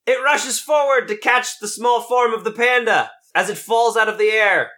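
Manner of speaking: usual speaking voice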